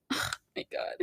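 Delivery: whispered sigh; exasperated whisper. Real, not acted. Podcaster sighing at re-recording, whispered. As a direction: breathe it out in frustration.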